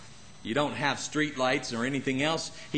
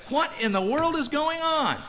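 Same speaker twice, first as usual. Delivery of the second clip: baffled; 12 semitones higher than usual. Retold or imitated. Enemies baffled after defeats.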